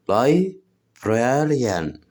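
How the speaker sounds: Librarian stretched very slowly for the class to copy; slow repeat together. Shown as a demonstration. Teacher says Librarian slowly so students repeat together. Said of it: "نقوله تاني مع بعض".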